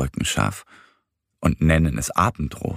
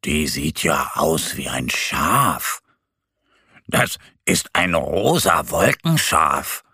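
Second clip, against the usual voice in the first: lower, slow, lightly voiced bear; little bear Mo; 3 semitones lower, slower than usual. A narrator's reading. Bear Mo tells Annie the cloud is a sheep, musing. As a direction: muse slowly like a gentle bear cub.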